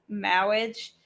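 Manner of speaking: "marriage" said as "mawwiage", r turned to w; Pope's "mawwiage". Retold or imitated. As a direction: stretch "marriage" into a lisping "mawwiage". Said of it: "when the Pope says".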